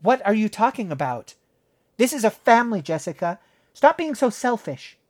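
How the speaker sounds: scolding mother. Acted. Mom scolding Jessica, sharp and angry. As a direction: snap at the selfish child.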